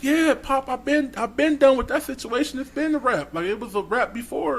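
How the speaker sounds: high-pitched, mildly nervous pleading; retold nervous reassurance. Suitor assures her dad it is over, high retold nervousness. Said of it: "I was shaking then".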